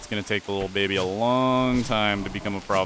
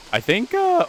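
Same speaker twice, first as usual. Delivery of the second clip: surprised; 5 dB louder than usual. Real, not acted. Sudden pitch jump.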